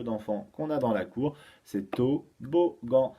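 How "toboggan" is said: toboggan slowly split as TO, BO, GAN; syllable by syllable. Shown as a demonstration. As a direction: reveal toboggan in three spaced beats. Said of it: "Tôt, beau".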